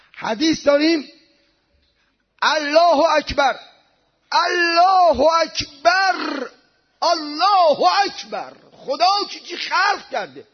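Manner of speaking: loud, high-pitched exclamations of awe; fervent pulpit cry; impassioned preaching. Real, not acted. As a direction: preach at full force, drawing out the praise.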